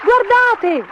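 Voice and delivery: put-on very high, loud children's cries; high-pitched kids calling out; excited shouts. A narrator's reading.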